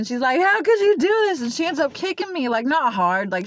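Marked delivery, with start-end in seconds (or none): 0.4-1.4 high, loud imitated anguished scream; crying and screaming. Grandma screaming at granddaughter who stabbed herself, loud high mock wail. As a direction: replay grandma's distraught scream, pitched way up. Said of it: "like, crying and screaming".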